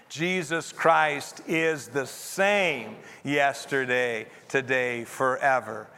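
slow recitation with "same" drawn out; measured group reading, "same" standing out; stress on SAME. Shown as a demonstration. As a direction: lean hard on the word "same". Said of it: "put the emphasis on a different word".

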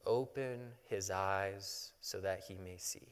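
slow, low, soft prayer; prayerful. Acted. Elisha praying over his panicking servant, quiet and prayerful. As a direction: pray it quietly and unhurriedly. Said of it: "And so he, he prays".

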